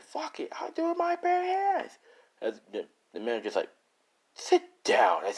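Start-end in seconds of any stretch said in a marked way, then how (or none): 0.0-1.9 much higher strained voice, squeaky with fury; shrill rage. Acted. Disarmed kid shrieking he will fight barehanded. Kid loses his knife, rages. 4.3-5.2 much higher and louder command; barked order. Acted. Manager ordering the kid to sit, barked.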